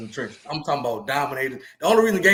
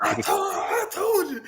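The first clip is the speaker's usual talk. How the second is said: high gloating taunt; slower than usual. Retold or imitated. Rival coach gloating, storming over.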